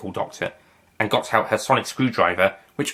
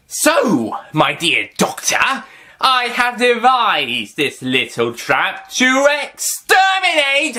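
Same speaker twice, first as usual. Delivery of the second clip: high, slow mock-villain gloat; 13 semitones higher, 3 dB louder, much slower than usual. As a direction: purr it out like a pantomime villain. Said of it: "that's the Daleks".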